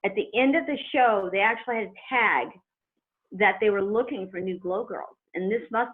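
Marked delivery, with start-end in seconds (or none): none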